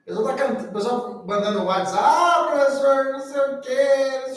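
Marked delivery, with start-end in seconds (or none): 2.0-4.3 whiny student. Retold or imitated.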